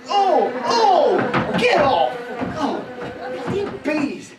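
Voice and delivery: high, loud yelps at attacking bees; alarmed shouting. Acted. Naked man fending off angry bees, alarmed shouting. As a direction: cry out and yell them away.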